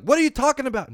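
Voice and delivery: high, loud exasperated cut-in; Bridget cutting him off. Retold or imitated. Bridget calling him out for rambling, exasperated. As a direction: snap back like a fed-up co-host. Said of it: "She'd be like".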